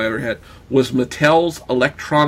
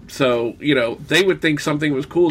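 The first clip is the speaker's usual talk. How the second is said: normal talking voice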